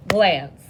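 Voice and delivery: "Glance" said loudly as one clapped beat. Shown as a demonstration. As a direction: clap out "Glance" as a single loud syllable. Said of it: "Go ahead and clap".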